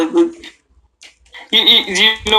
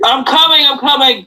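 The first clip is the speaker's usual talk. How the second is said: calling out; 7 semitones higher than usual. Real, not acted. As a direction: call back to someone away. Calling out to someone.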